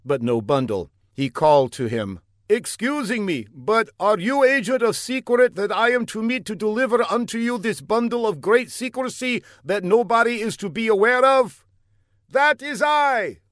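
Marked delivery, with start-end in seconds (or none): 2.5-11.6 earnest, high put-on foreign voice; an eager young spy calling out; high comic foreigner. A narrator's reading. Fla calls down the block to the older man, high and eager. 12.3-13.4 high, slow, bellowed reply; comic bellow. A narrator's reading. Older man bellows back to Fla, high and slow. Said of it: "the other man bellowed back".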